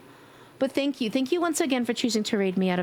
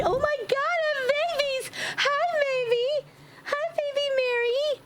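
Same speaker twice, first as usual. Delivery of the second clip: very high cooing voice. Real, not acted. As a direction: coo at the little babies. Cooing at newborn game babies.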